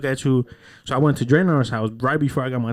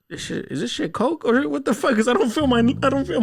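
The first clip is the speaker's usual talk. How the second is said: mock shocked; 12 semitones higher than usual. Retold shock at numb tongue.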